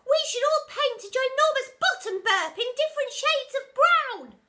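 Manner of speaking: put-on very high, loud silly child; cheeky kid. A narrator's reading. Naughty pupil shouting a rude idea, very high. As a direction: suggest it with glee.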